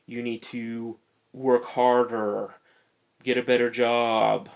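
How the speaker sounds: slow, drawn-out mock command; voice of society. Society bombarding people with demands, mock slow ordering. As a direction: lean on each order, imitating a nagging society. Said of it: "constant bombardment from society saying".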